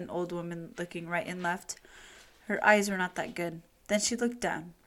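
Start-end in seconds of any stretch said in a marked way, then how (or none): none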